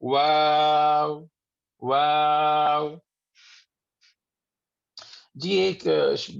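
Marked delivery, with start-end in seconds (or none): 0.0-2.9 "واو" drawn out long on a level note; slow, sustained Arabic "واو"; rounded-lip waw. Shown as a demonstration. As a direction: round both lips and stretch "واو". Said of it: "زبان کو پیچھے سے تھوڑا سا اٹھا لیں".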